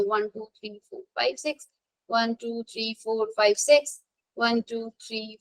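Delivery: soft, low-key counting to oneself; counting under breath. Real, not acted. Teacher tallying electrons on the board, counting under breath. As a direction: count softly while working it out.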